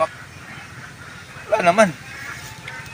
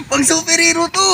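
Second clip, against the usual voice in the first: high, loud thrilled cry; excited shout; 12 semitones higher, 5 dB louder than usual. Acted. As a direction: yell it with glee. Thrilled at finding superhero gear.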